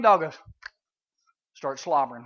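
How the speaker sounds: ordinary talk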